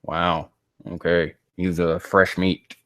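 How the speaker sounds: normal talking voice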